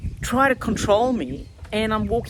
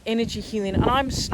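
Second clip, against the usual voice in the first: ordinary talk, nothing marked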